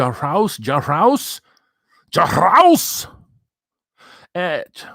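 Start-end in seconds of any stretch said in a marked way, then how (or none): 2.1-3.1 higher, louder mock disbelief; put-on shocked repeat of the name; incredulous. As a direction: act amazed at the name.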